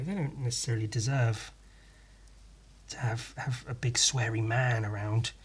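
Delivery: soft unvoiced whisper; hushed confiding whisper; whispered aside. Real, not acted. Whispered aside about the kid.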